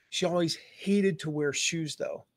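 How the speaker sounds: usual speaking voice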